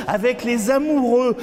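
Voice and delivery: fast, high, excited declamation; quick high-pitched burst of recitation; urgent litany. Acted. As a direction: rush it out high between refrains. Verse rushed between refrains.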